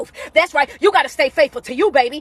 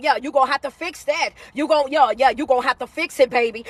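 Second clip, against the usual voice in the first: ordinary talk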